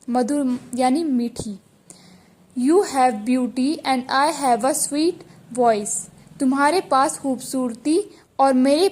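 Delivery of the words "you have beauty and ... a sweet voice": slow dictation reading. Shown as a demonstration. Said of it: "teacher reads the sentence slowly".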